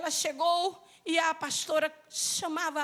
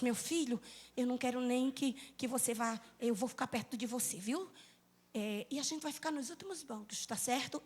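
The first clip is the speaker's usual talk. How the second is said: much softer, lower anxious murmur to her son; worried hushed mother; 5 semitones lower, 11 dB quieter than usual. Retold or imitated. Mother to son in church, low worried hush. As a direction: keep it hushed so no one hears.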